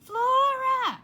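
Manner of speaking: very high, very loud shout. Acted. Mother shouting for Flora from downstairs. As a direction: yell her name across the house. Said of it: "her mother shouted".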